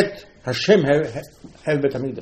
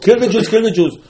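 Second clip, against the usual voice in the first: loud, high chant of the decree; proclaimed loudly and high; decree cry; 8 semitones higher, 8 dB louder than usual. Retold or imitated. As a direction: call it out like the decree. Quoting the decree.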